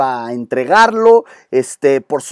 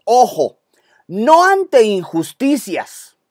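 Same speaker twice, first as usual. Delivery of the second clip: ordinary talk, nothing marked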